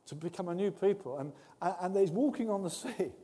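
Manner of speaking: usual speaking voice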